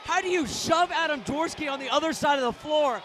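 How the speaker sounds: loud, high outraged yelling at the refs; furious shout. Real, not acted. Commentator yelling at the refs over a foul call, furious shout. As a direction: blast the officials, fully furious.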